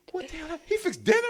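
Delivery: a dazed victim staring in surprise; the choked woman waking up confused, pitched high; mock bewildered woman.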